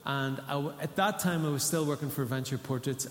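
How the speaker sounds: ordinary talk, nothing marked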